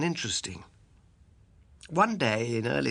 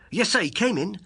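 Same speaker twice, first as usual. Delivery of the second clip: high, loud eager reply; page boy; 8 semitones higher, 6 dB louder than usual. Acted. Page boy answers Holmes's question, high and eager. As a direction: pipe up like a young servant.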